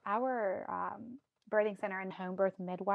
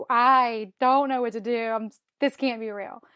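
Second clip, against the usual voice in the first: retold panic; 3 semitones higher, 10 dB louder than usual. Telling viewers how the test shocked her, mock panicked. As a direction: act out a panicked outburst. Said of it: "And I'm panicking".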